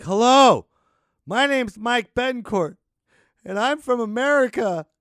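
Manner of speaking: high, loud, slow announcement of himself; performed plea introduction. Pretend. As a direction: call out like a desperate newcomer. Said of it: "this is what I'm gonna do".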